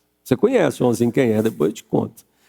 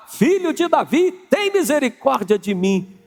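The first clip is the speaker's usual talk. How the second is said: high, urgent cry to Jesus; pleading shout, much higher; crying out Son of David; 8 semitones higher than usual. Retold or imitated. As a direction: call out desperately as the blind man on the roadside. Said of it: "ele gritava, ele clamava".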